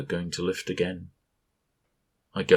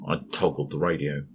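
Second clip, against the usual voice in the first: ordinary delivery, nothing marked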